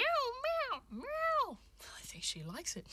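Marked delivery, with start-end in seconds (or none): 0.0-1.6 high meows that rise and fall; high, swooping kitty meows; cat meowing. Pretend. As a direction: answer as a pleased cat. Cat Diddle answers her.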